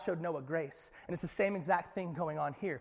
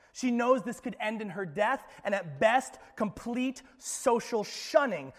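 louder, higher urgent preaching; preaching at full force; 4 semitones higher, 8 dB louder than usual. Acted. Preacher warns the church of Mary's risk, urgent and loud. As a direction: press the danger on the listeners.

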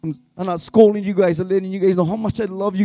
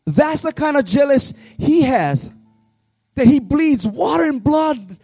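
impassioned preacher, raised voice; preaching at full force; 3 semitones higher than usual. Real, not acted. Youth pastor preaching to his church, impassioned.

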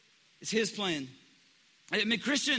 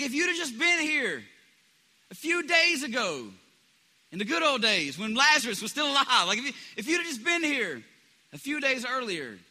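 higher, louder reproachful plea; a sister reproaching her friend, pitched higher and louder; Martha's complaint; 5 semitones higher, 4 dB louder than usual. Retold or imitated. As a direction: voice grieving Martha half-blaming Jesus. Martha voiced blaming Jesus.

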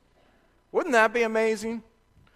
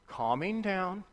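put-on softly hushing the kids to calm them; gentle calming; 9 dB quieter, slower than usual. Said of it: "I'd go".

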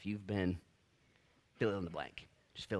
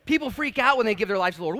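ordinary talk

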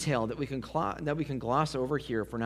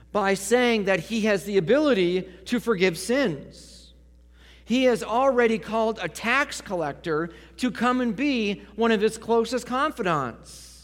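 raised, insistent pulpit voice; forceful preaching; 6 semitones higher, 5 dB louder than usual. Acted. Preacher listing the leaders' charges against Jesus, forceful preaching. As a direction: preach it louder and higher, building the case.

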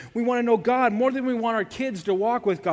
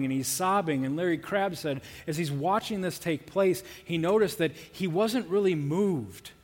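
ordinary talk

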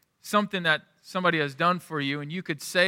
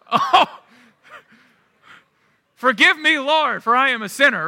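high, loud, hammed-up cry for mercy; mock remorse; 8 semitones higher, 8 dB louder than usual. Guilty dad praying to the Lord, hammed-up remorse. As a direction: burst into an exaggerated prayer of guilt, half laughing. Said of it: "that's what I was feeling in that moment".

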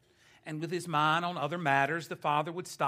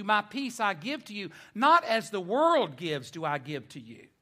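raised, emphatic pulpit delivery; preaching; 5 semitones higher, 6 dB louder than usual. Acted. Preacher explains God's unique peace, emphatic preaching. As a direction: proclaim it to the congregation point by point.

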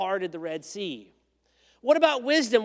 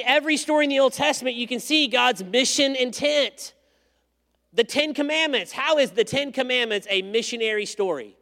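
louder, driving sermon; preaching at full force; 4 semitones higher, 6 dB louder than usual. Acted. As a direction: preach it loud to the congregation. Preacher builds to the point.

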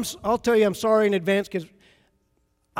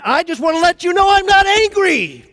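loud, high, insisting he's not angry; an angry man loudly swearing he's calm; angry denial; 9 semitones higher, 9 dB louder than usual. Retold or imitated. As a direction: shout the denial through clenched anger. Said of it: "if that's you not being angry".

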